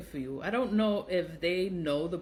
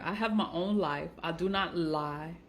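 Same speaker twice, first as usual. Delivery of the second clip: ordinary talk, nothing marked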